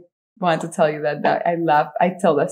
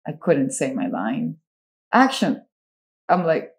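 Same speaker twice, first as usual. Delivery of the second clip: normal talking voice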